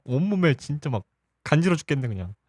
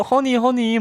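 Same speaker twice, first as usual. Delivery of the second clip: cooing pet name; 9 semitones higher, slower than usual. Retold or imitated. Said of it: "막 이렇게 하면은".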